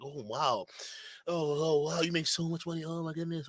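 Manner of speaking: softly hammed-up insecure gush; mock awed insecure man. Mocking insecure man awed by money.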